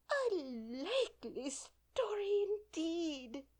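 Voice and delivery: high, slow, scornful sneer; deep contempt. A narrator's reading. Pigeon scorns Alice's claim with contempt. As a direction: drag it out with scorn. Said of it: "in a tone of the deepest contempt".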